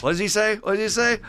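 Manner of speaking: high, loud, repeated urging; eager prompting. Acted. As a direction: press for the punchline excitedly. Acting eagerly waiting for Jim's line.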